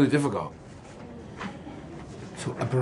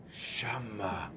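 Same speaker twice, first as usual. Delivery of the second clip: whisper; 7 semitones lower, 12 dB quieter than usual. Shown as a demonstration. Teacher whispers Shama to the class for emphasis. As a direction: whisper Shama, unvoiced and soft.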